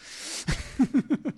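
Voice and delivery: breathy amused chuckle; laugh. Real, not acted. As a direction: chuckle at your own slip. Joking about his own accent.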